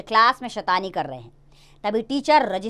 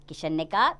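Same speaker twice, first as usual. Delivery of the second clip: usual speaking voice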